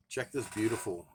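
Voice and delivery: normal talking voice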